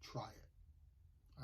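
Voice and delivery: a quiet, breathy whisper with no voice; confident hushed whisper to a doubting diner; hushed waiter. Retold or imitated. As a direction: lean in and whisper it like a knowing waiter. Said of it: "he goes".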